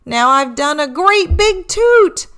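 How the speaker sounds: sheepish reindeer, a touch embarrassed; mildly embarrassed Rudy; lightly voiced Rudy. A narrator's reading. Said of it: "said Rudy".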